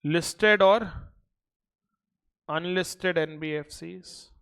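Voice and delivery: slow, even dictation pace. Acted. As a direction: read it out for notes. Dictates notes to class.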